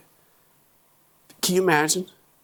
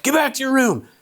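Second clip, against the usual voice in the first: barked order; 14 semitones higher than usual. Retold or imitated. A fed-up dad barks at his daughter in the hall. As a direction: snap them back to bed. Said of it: "And I barked".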